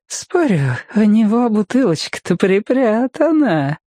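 high tipsy lady's voice; put-on high feminine voice; drunk woman. A narrator's reading. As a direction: pitch it high, slyly wheedling. Drunk woman coaxes her companions.

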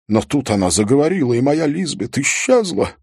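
fast, high, loud outburst; agitated producer. Acted. Producer describing the lost illusion of Lisbeth, agitated. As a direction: rush it out, pitch climbing towards the end.

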